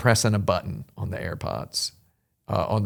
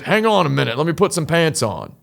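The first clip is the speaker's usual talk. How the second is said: imitating a neighbor, raised high and loud; high-pitched, loud impression of a stranger; man at a window; 6 semitones higher, 5 dB louder than usual. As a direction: call back down from the window. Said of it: "And he goes".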